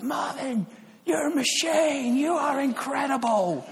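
very high, drawn-out rave; ecstatic cheer. Retold or imitated. Fan running up to the tournament hero, ecstatic cheer. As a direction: gush at the champion, stretching the words way up high. Retold rush up to the winner.

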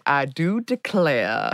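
slow, drawn-out Southern drawl; bad Southern accent. Shown as a demonstration. Showing co-hosts her only Southern line, bad Southern accent. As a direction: stretch it into a caricature Southern accent. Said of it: "do bad Southern accents".

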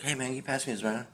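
fast, quiet, offhand ask; deadpan prank. Retold or imitated. Bored at Friendly's, pranking friends with a fast quiet ask. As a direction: rattle it off softly, straight-faced. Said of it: "I'd always be like".

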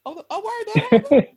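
high, laughing fake shock; mock surprised gasp. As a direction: gasp it out with a giggle. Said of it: "He'd be like".

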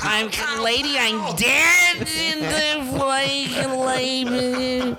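hammed-up old comic calling lady; Cosby impression. A podcast host hams up a comic's voice, calling out lady.

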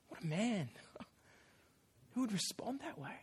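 breathless whisper with a laugh; awed whisper. Real, not acted. Preacher marvels at David to congregation, whispering. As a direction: whisper it in wonder. Awed aside after the story.

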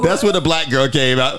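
usual speaking voice

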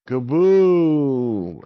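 big, slow, drawn-out boom; dramatic kaboom. A narrator's reading. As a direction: stretch it out like an explosion. Storyteller acts out the explosion.

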